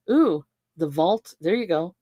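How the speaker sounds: ordinary talk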